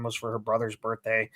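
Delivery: ordinary talk, nothing marked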